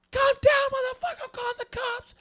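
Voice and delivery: very high shrieked threat, loud at the start; mock screaming mom. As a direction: imitate a mom yelling at an intruder. Mom threatens to call police.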